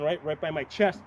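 usual speaking voice

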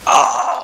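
a startled cry as he falls; real pained yelp of surprise; sudden yelp. Real, not acted. Thrown off his horse.

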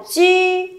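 "chi" held level and high, no puff of air; unaspirated ü syllable. Shown as a demonstration. Teacher confirms answer to class, "chi" unaspirated and sustained. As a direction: say "chi" with rounded-lip ee and unaspirated onset. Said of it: "chính xác là chi".

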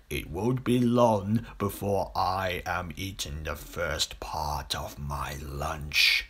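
low, slow whisper, fading to breath; crocodile whispering. A narrator's reading. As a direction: gloat quietly over the coming meal. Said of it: "he whispered to himself".

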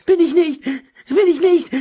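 high, loud, breathless denial; desperate terrified pleading; panting protest. A narrator's reading. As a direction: gasp it out while sliding down. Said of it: "beteuerte Shortblond keuchend".

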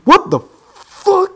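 high, slow, loud disbelief; shocked yelp. Real, not acted. Player startled by a game level, shocked yelp. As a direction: squeal it out in shock.

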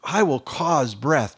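loud, high, fervent proclamation; Isaiah crying woe is me, loud; preaching at full force. Acted.

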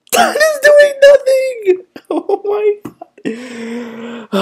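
very high, drawn-out squeal; shriek, then tail off into a wail; helpless high-pitched screech; freaking out. Real, not acted. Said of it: "Dude, I'm freaking out".

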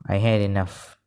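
ordinary talk

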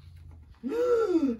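high, loud mock gasp; frightened gasp. Elephant hearing the threat, big startled gasp. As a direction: suck in air in pretend fright.

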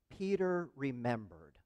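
slow, soft and weighty; deliberate repetition. Retold or imitated. Preacher repeating the key words to the congregation, slow and soft. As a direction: say it softly, letting it sink in.

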